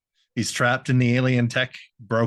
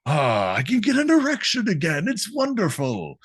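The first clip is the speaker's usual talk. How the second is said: revived ghost. Acted. Revived ghost revels in having flesh and blood, hammed-up delight. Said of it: "'cause one even says".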